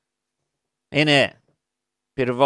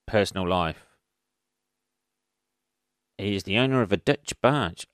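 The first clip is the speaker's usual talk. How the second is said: ordinary delivery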